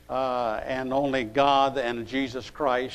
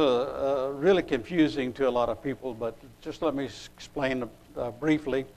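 usual speaking voice

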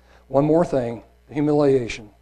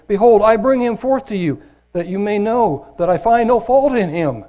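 loud, higher public proclamation; Pilate proclaiming; 8 semitones higher, 7 dB louder than usual. Acted. Pilate announcing to the crowd, loud and high. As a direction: call it out to the crowd.

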